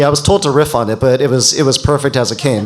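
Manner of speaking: usual speaking voice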